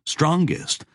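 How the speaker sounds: usual speaking voice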